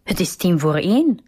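plain reading voice

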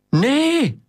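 high-pitched cry of "no"; a woman's high, drawn-out shout. A narrator's reading. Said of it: "riep een vrouw".

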